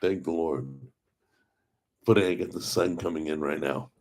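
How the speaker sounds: ordinary talk, nothing marked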